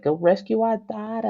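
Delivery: high-pitched mock New York Italian wife; hammed-up New York accent, nagging wife. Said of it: "I'm gonna do a New York accent".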